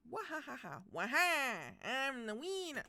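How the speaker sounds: high, cartoonish Waluigi cackle. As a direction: gloat like Waluigi. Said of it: "Maybe he is Waluigi".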